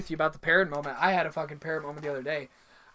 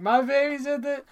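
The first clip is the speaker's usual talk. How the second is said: mock crying parent; 9 semitones higher, 4 dB louder than usual. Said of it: "crying and saying".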